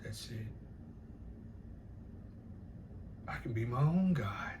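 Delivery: hushed retelling of a meditator's words; meditative. Retold or imitated. Man meditating alone reaches his conclusion, quiet and meditative. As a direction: imitate a quiet, private realization. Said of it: "he was just meditating".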